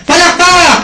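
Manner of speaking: loud, high shouted call; clap cue shout. Shown as a demonstration. Speaker repeating the clap drill with the audience, shouted cue. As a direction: shout it out to wake the room. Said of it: "Ulitin nga natin".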